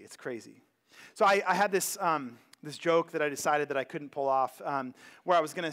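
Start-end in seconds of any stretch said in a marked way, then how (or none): 0.0-0.6 the repeat fades to a near-murmur; quiet echo. Real, not acted. Pastor repeating to the congregation, trailing off quietly. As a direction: let it drop away, almost to yourself. Repeats the line, second fading.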